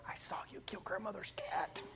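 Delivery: sly whispered threat; hushed, sneaky whisper to her brother. Retold or imitated. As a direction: whisper it like a tattling little sister. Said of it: "She goes".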